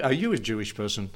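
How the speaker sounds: ordinary delivery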